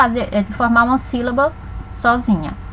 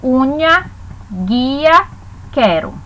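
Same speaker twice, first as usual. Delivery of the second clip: syllable by syllable; much slower than usual. Shown as a demonstration. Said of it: "Vamos aos exemplos".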